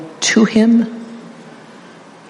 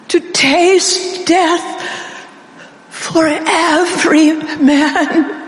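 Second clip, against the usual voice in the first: higher, fervent sermon voice, slowing on key words; impassioned preaching; 6 semitones higher than usual. Acted. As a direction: preach it with rising passion. Preaching at full force.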